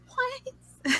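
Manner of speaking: high, baffled "what?"; squeaky disbelieving what; confused what. Retold or imitated. Confused reaction in retold story.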